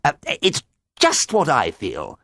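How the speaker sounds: high, flustered agreement; eager cry. A narrator's reading. Smitten farmer agrees with his caller, high eager cry. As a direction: stumble in, then cry it out. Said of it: "Fendleton cried".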